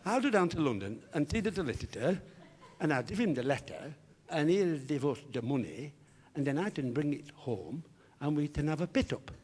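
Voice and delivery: eager speech-defect voice, g turned to d; comic impeded consonants; Harry. Acted. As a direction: explain the plan with fronted, t-and-d diction. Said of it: "a slight speech defect".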